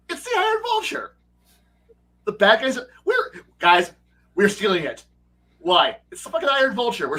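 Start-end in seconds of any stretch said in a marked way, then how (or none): 0.1-1.1 excited. Retold or imitated. Repeats it in disbelieving excitement.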